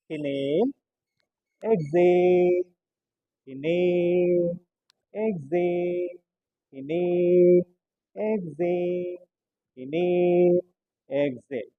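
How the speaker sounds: long drawn-out breathing cues; slow breathing cues. Acted. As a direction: stretch every word, calm and even.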